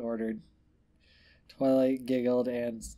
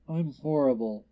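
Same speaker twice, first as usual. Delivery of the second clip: crying; 2 semitones higher, 4 dB louder than usual. Acted. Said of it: "Twilight cried".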